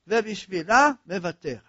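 ordinary talk, nothing marked

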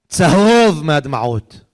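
צהוב drawn out long and loud; stress on צהוב. A narrator's reading. Preacher describing his mother's tear-stained Psalms, stressing צהוב. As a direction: stretch צהוב slowly for emphasis.

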